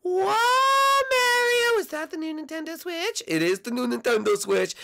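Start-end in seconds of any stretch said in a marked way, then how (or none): 0.0-3.2 high falsetto princess in awe; Peach. Acted. Peach marvels at Mario's Switch.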